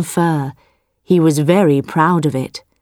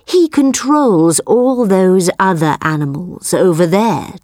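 curious tiger voice. Acted. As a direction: ask it like a curious big cat. Tiger questions the buffalo.

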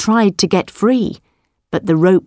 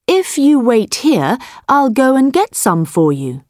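higher, bright sly voice; quick-thinking farmer; 3 semitones higher than usual. Acted. Farmer fools the tiger, higher and sly. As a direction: talk him round with a cunning smile.

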